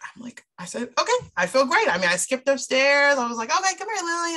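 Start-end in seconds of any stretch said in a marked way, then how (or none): 1.0-1.9 high, bright burst of relief; suddenly happy and light; much higher, louder relieved okay; relieved joy. Retold or imitated. Relieved after prayer answer. 3.5-4.4 put-on high, sing-song call to a child; calling Lily upstairs happily; much higher cheerful summons. Calling daughter, skipping upstairs.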